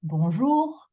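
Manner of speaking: bonjour with JOUR lifted and stretched; extra final intonation. Shown as a demonstration. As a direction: raise and hold the JOUR of bonjour. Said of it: "intonation on that last bit".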